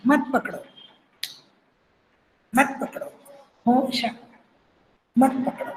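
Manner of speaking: softly voiced refusal of a lover, with pauses; wronged heroine. Khandita heroine telling Shyam not to hold her, softly rebuffing. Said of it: "ये खंडित है".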